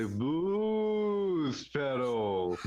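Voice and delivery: drawn-out, hammed-up pitch for the pedal; shilly ad read. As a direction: sell it like a cheesy ad. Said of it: "but even more shilly?".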